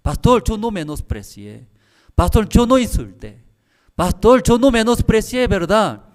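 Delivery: rising, loud pleading denial; protesting. Retold or imitated. Seminarian denying to the angry pastor, loud and pleading. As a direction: insist on innocence to the pastor.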